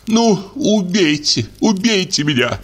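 high, strained cry of despair. A narrator's reading. Olga hysterically cries out to Ryabovsky, high and strained. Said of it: "крикнула Ольга Ивановна".

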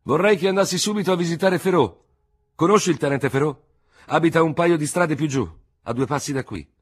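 officer urging the doctor to go at once; urgent lieutenant. Acted. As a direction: push him to hurry. Lieutenant urges the surgeon.

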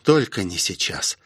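ordinary delivery

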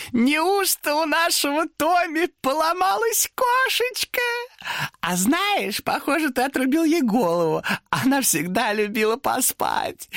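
high, slow mocking demon with a laugh; gloating demon. Demon gloats to Thomas over the cat. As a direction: savour every cruel word.

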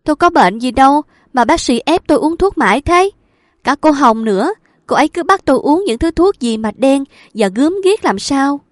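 high, loud complaining outburst; shrill protest. A narrator's reading. Patient protesting to the doctor about medicine, high loud complaint. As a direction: push back against the medicine, indignant.

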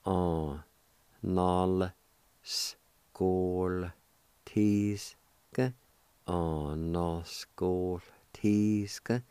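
anaskoltiske stretched out very slowly, syllable by syllable; slow teaching demo of anaskoltiske; drawn out slowly. Shown as a demonstration. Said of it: "You can pronounce the L with the G O like this".

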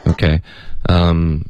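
usual speaking voice

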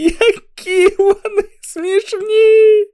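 wailing with laughter; laughing almost to tears. Acted. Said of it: "ледь не плакав від сміху".

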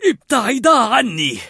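put-on loud, high, slow shout of an old man; an old man warding off dogs. A narrator's reading. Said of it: "فصرخ كارل".

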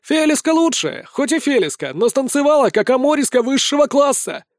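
high, eager championing; excited crowd shout. A narrator's reading. Spectator backing a favourite dancer, excited shouting. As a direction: argue for your favourite, pitched high.

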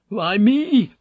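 high, loud anguished cry; inward scream. A narrator's reading. Vink's mind protesting his death sentence, screamed. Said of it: "his brain screamed".

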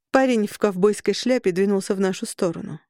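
ordinary delivery, nothing marked

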